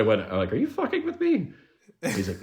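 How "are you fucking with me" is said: high-pitched retold disbelief; acted-out incredulous question. Retold disbelieving reply to a compliment.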